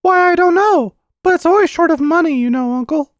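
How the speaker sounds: put-on very high, loud child voice; nervous little boy. Boy asked what the house whispers, high and nervous. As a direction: falter like a nervous small boy.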